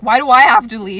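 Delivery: whiny protest. Pretend. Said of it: "Watch me stand there and be like".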